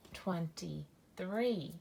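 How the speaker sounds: ordinary talk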